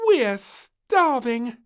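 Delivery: high, loud, slow hungry whine; starving little princess. A narrator's reading. Princess eyeing the picnic, high drawn-out. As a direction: stretch it out greedily.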